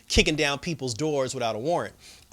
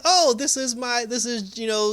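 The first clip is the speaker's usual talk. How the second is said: high, drawn-out retold greeting; over-explaining introduction; 9 semitones higher, 3 dB louder, slower than usual. Lynn introducing Jennifer to her visitor, over-explaining. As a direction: draw out a high, chirpy "oh".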